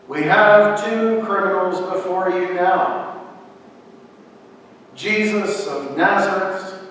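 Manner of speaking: loud proclamation. A narrator's reading. Pilate offers the crowd a prisoner's release, proclaiming loudly. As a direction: address a whole crowd as the governor.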